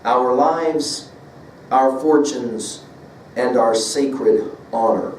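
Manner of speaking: slow, with a pause before each phrase; solemn slow reading. Shown as a demonstration. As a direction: let each item land slowly and gravely. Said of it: "emphasis of the word honor as the very last".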